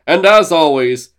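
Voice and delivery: lightly voiced colonel giving orders, slowing at the end; a hint of a pompous military boss. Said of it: "He's the colonel".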